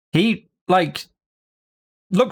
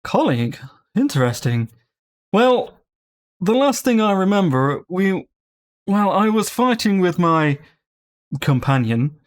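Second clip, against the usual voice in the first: a puzzled pompous blade recalling a fight; hint of an aristocratic sword; bougie sword; 3 semitones higher than usual. Sword continues.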